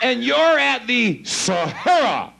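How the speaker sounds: slow, stretched-out stage proclamation; grand showman announcement. Real, not acted. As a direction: draw out each word for the whole house. Showman closing, calling trigger word.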